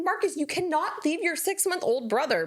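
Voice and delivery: put-on high scolding, child's name first; firm mom telling off her son; scolding Marcus. Mom scolding son for leaving baby, stern. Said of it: "so I'm like".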